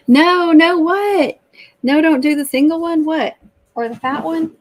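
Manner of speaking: high, loud startled protest; real loud surprise; loud disbelief. Real, not acted. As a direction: blurt it out in shock. Reacting in disbelief to chat.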